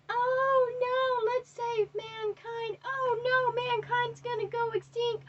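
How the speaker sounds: high, loud mock wail; exaggerated high-pitched lament, making fun of criers; mock crying. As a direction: wail in hammed-up despair, stretching the words. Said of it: "I'm not crying, okay?".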